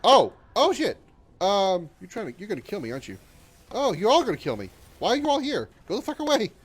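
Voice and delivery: high, loud panicked yelps; real alarm at being swarmed; gaming panic. Real, not acted. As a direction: freak out as they close in. Panicking as enemies swarm in game.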